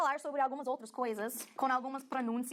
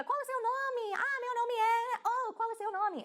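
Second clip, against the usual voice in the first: high, lively stranger asking a name; curious stranger; 10 semitones higher, slower than usual. Retold or imitated. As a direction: ask a name brightly like a newcomer. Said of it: "alguém chega".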